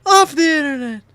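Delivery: squeaky high echo; high mock voice. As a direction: join in the high parody. Echoing the mock voice.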